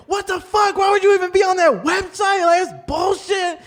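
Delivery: retelling a friend's outburst, in a high mock outraged squeal; squeaky indignant girlfriend impression; high mock outrage. She was like, in a high mock outraged squeal. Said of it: "she was like".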